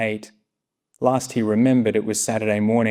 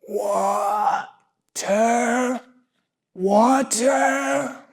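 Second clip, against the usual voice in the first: much higher, weak croaking plea for water; sick dog begging for a drink, higher; hoarse pleading dog; 12 semitones higher, much slower than usual. Acted. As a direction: beg for water with a dry throat. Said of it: "Swallowing hard, he tried once more".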